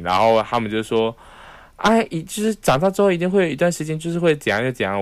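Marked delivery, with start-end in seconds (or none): 1.8-5.0 high, quick put-on auntie voice; lightly voiced fussy elder; mock nagging relative. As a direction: parody a relative who knows best. Voicing relatives' prediction.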